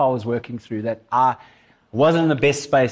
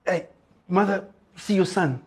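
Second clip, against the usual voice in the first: strained, higher voice of a dying man; dying, broken; 4 semitones higher, 4 dB quieter than usual. Acted. Jesus on the cross to his mother, broken and strained. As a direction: push it out with little breath left. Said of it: "He takes the time in his brokenness".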